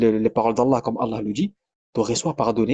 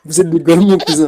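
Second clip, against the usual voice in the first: laughing; 3 semitones higher, 6 dB louder than usual. Real, not acted. Mock rant laughing.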